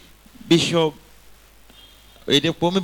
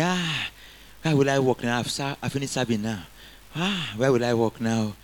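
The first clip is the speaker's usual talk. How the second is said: mock lament; 5 semitones lower, 6 dB quieter than usual. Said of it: "when you wake up in the morning, say".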